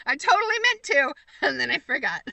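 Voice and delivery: mock epitaph. Reading her own tombstone aloud in mock grandeur. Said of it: "You can just put that on my tombstone".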